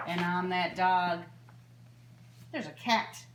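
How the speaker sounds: lilting singsong storybook read; reading to children. A narrator's reading. Librarian reading a bedtime book to children, gently lilting. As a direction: read it like story time for little ones. Reads picture book aloud.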